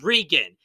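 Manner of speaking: Regan with a long ee, REE-gun; mispronounced name. Shown as a demonstration. Host quoting YouTubers' mistake, Regan said REE-gun. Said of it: "They pronounce it as".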